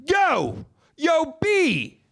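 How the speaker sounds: very high, loud shouted call. Retold or imitated. Steve yells across the restaurant to Brian, loud high call. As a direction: holler across the McDonald's for attention. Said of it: "he just starts".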